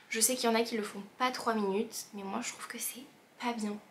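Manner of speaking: fast conspiratorial whisper. Real, not acted. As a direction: whisper it like a secret. Whispered confession-like aside.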